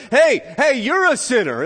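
high, loud calling-out; acted accusatory shout; shouting accuser. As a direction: holler it at passers-by like a riverbank prophet. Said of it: "he's shouting".

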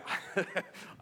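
a short, breathy chuckle to yourself; breathy laugh. Real, not acted. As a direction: laugh it off quietly, unconvinced. Rueful laugh at interpretation.

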